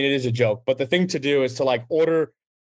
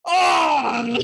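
group shout; 10 semitones higher than usual. Retold or imitated. Germans catching the handed-off Radler, mock shout. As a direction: yell it like a table catching you out. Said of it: "they were like".

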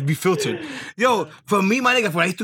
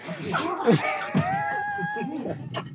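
shrieking laugh; 7 dB quieter than usual. Real, not acted. Hearing the woman is his cousin, shrieking laugh. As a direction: howl with laughter.

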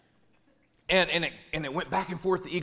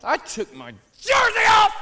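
higher, louder furious shout; shouting in frustration over the loss; angry shout; 10 dB louder than usual. Acted. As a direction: yell it out in fury. Angry outburst retold.

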